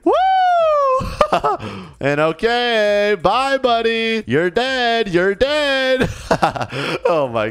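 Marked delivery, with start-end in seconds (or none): 0.2-2.0 laughing. Real, not acted. After grenade jump across map.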